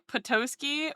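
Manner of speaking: normal talking voice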